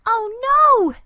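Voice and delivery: high, loud cry of dismay; a boy seeing the mess he made; loud child's wail of dismay. A narrator's reading. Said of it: "Andy cried".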